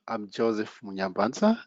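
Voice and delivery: normal talking voice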